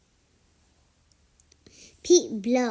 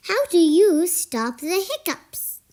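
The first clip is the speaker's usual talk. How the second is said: ordinary delivery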